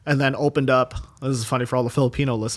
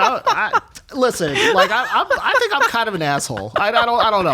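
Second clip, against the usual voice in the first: laughing; 10 semitones higher, 4 dB louder than usual. Real, not acted. Laughing self-deprecating confession.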